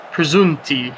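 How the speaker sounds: ordinary talk, nothing marked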